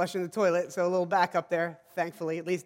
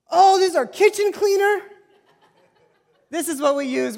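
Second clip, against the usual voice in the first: much higher and louder, laughing at own mistake; horrified laugh at the discovery; laughing in disbelief; laughing realization; 10 semitones higher, 8 dB louder than usual. Retold or imitated. Realising he used cleaner.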